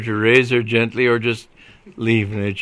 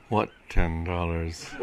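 low, slow, quiet question; cool non-recognition; 4 semitones lower, 6 dB quieter, slower than usual. A narrator's reading. Sergeant to the man offering a bribe, coolly blank. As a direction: ask it slowly, low and softly, giving nothing away.

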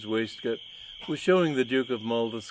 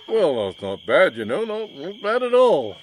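booming cheerful praise, louder; hearty loud character voice; 7 semitones higher, 9 dB louder than usual. Acted. Hearty man praising Sir Elmer, loud and cheery. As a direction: bluff hearty fellow reassuring a rival.

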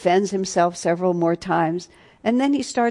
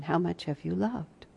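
soft question; 9 dB quieter than usual. Real, not acted. Softly repeated final question.

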